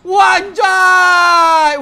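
loud drawn-out disbelief; amazed shout. Real, not acted. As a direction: yell it out in awe. Amazed at the Ultra Sword.

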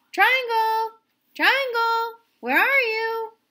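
high, loud calling into the dark. Acted. Circle calls for Triangle behind the waterfall: loud high calls. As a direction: call loudly for a lost friend. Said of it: "Circle called out".

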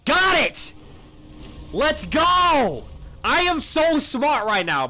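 loud, high victory shout; triumphant cheer. Real, not acted. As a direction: celebrate the win. Solving puzzle triumph.

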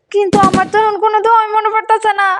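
high, panicked pleading. Acted. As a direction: cry for help in terror. Girl panics, forgot prayers.

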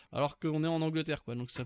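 ordinary talk

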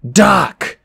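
loud, high warning yell; Grover yelling. A narrator's reading. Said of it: "Grover yelled".

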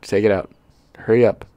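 usual speaking voice

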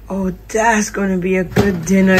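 usual speaking voice

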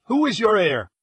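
high, loud sharp demand. A narrator's reading. Inspector pressing the millionaire about his heir, sharp and high. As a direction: fire the question at him like an accusation. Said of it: "he said sharply".

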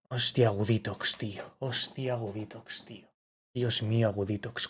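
soft disbelieving repetition; hushed awe. Real, not acted. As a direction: savour it quietly to yourself. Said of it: "repeats it low and hushed".

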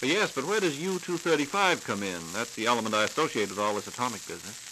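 normal talking voice

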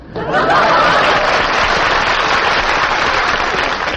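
long chuckle; hearty laugh. Real, not acted. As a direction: laugh at your own gag. Laughing at his own joke.

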